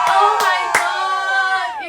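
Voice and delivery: slow, high, very loud shout of joy; ecstatic scream. Retold or imitated. Student screams with joy at the full scholarship. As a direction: let it burst out and stretch.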